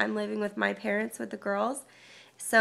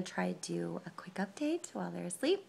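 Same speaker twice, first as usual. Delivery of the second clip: whisper; 3 semitones lower, 8 dB quieter than usual. Real, not acted. Kids asleep nearby.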